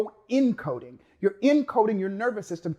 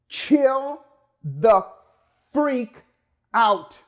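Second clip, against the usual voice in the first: higher and louder, each word punched apart; emphatic punchline; 4 semitones higher, 5 dB louder, much slower than usual. Shown as a demonstration. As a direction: land it like a punchline with long pauses. Punchline of his chill advice.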